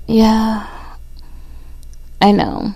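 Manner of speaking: loud, intimate spoken-word reading; poem recital. Acted.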